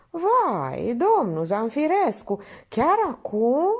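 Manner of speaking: whining. A narrator's reading. Minerva whines to the painter about starting now, mewling. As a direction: whine it like a coy lady. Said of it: "se miorlăi Minerva".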